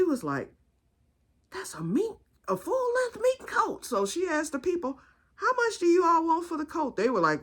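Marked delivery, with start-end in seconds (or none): 1.5-3.8 high, hushed awe of a proper aunt; Aunt Ada. Retold or imitated. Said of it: "and this is how she talks". 5.3-7.0 high-pitched, proper aunt asking politely; Aunt Ada. Retold or imitated. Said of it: "and this is how she talks".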